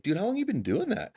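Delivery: high-pitched mock amazement; amazed question. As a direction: imitate a coworker who can't believe it. Tile guy amazed at shower pan.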